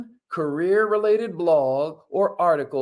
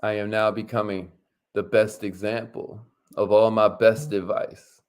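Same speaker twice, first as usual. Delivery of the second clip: affirmation reading; 7 semitones lower than usual. Shown as a demonstration. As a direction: lead them, word by word. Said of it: "Say it with us".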